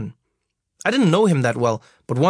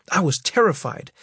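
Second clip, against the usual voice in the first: ordinary delivery, nothing marked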